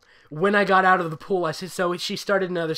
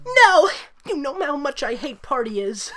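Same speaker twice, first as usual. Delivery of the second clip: put-on whiny girl voice; 10 semitones higher, 4 dB louder than usual. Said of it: "I cut her off".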